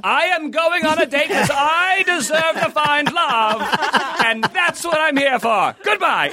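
high-pitched, hammed-up proclamation; mock declaration. Imitating Chris confronting the group, hammed-up high declaration. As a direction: declare it grandly in a high mocking voice. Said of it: "confront everyone in the group and declare".

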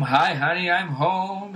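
hammed-up sitcom husband greeting. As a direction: call it out like a fifties TV husband. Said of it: "Dude comes home".

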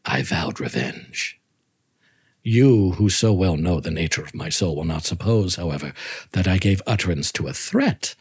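low, measured dark reading; story narration. A narrator's reading. Reads Poe story aloud.

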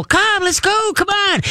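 very high, slow hey let's go this way; high coaxing call. Shown as a demonstration. Owner luring a dog a block away, very high coaxing call. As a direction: coax the dog with a sing-song lure. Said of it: "squeak, squeak, squeak".

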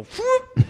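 sudden, very high whoop. Retold or imitated. Imitating Carell effortlessly topping him, high whoop. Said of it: "he would just go".

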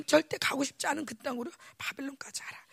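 hushed whisper of a command. Retold or imitated. God telling the people to go to Babylon, whispered. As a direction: let the command sink into a whisper.

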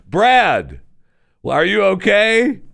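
half-hearted imitated shout to a fallen brother; calling out. Retold brother calls to fallen brother.

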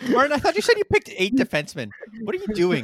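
loud, high-pitched disbelief; incredulous outburst. Real, not acted. Host catching a co-host's blunder, loud incredulous outburst. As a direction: call him out, high and loud with amusement. Host exclaims at Martin's pick count.

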